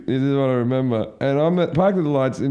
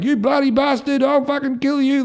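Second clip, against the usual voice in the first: high-pitched outraged threat; acted angry threat; 12 semitones higher than usual. Thrown man gets up at the driver, imitated fury. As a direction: mimic an enraged drunk making threats.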